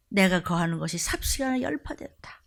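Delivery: whispered prophecy of sudden destruction. Acted. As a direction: murmur the doom in a hush. Scripture read as lament.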